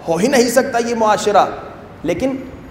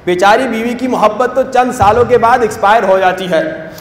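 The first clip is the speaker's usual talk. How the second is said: ordinary talk, nothing marked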